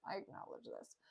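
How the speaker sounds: barely audible whispered aside; under the breath. Retold or imitated. Grumio conceding some people were unprepared, whispered. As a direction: repeat it as a tiny muttered admission.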